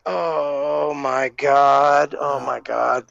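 low, drawn-out mock dread; dismayed groan. Production manager realizing he ruined the Undertaker's entrance, dismayed groan. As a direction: groan it very slowly, sinking low in horror. Horrified at causing the blackout.

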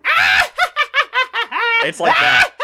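wild shrieking villain cackle; maniacal laugh. Shown as a demonstration. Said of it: "your weird maniacal laugh".

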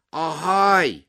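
high, loud hail; calling out. A narrator's reading. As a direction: shout for attention from behind a door. Voice calls from inside locked garage. (فریاد برای جلب توجه)

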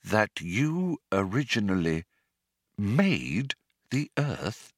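halting, very slow, restrained disbelief; quietly measured, pausing between phrases; slow and controlled. Acted. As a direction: spell it out slowly with tight control. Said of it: "slowly and with control".

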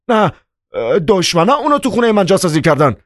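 high, rushed, panicked denial; terrified cry. A narrator's reading. Chairman denies the package is his, terrified cry. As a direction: blurt it out in high-pitched terror. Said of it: "با صدایی وحشت زده فریاد زد".

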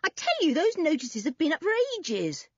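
high, insistent irritation; cross. A narrator's reading. Boy defending his word to his friends, cross. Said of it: "sounding cross".

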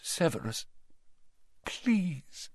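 soft, faint plea; pleading. A narrator's reading. Dumbledore begs Snape, softly pleading. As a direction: beg very softly, leaving space between words. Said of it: "Dumbledore was pleading".

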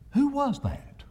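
high, scared little voice; trembling child voice. A narrator's reading. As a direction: whimper it like a frightened child. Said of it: "a trembling voice asked the other".

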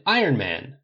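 a touch of a cheering shout; crowd shouting. A narrator's reading. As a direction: call it out like one of the crowd. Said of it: "the crowd starts shouting out".

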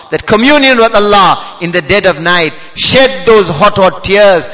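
usual speaking voice

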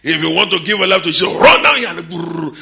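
high, very loud crusade yell, last words stretched; shouted altar call. Retold or imitated. Young preacher calling converts at the crusade, shouted altar call. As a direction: order sinners forward at full blast.

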